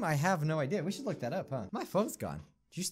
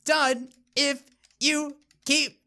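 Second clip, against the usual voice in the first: higher, louder, slowed mock nagging; mock scolding voice; 8 semitones higher, 5 dB louder, slower than usual. Mock scolding the game maker, higher and louder. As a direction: nag like a fussy scold, hammed-up.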